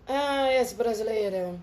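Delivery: slow, low, knowing mock doctor; a slow knowing Portuguese doctor. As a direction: drawl it like a doctor who just understood. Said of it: "ele olhou minha ficha e disse".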